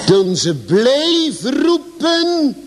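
roepen stretched slow and high; slow emphatic stress on roepen; drawn-out emphasis. Acted. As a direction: lean hard on roepen, slowly. Preacher drawing out key word.

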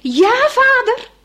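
high, loud, drawn-out answering call; boy calling up to the fisherman; calling back. Acted. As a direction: shout back up to the hut. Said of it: "En hij roept".

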